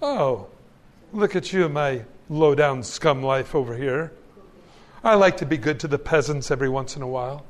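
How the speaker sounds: lightly voiced proud snob; haughty. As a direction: sneer down at the peasants. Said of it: "with a haughty spirit saying".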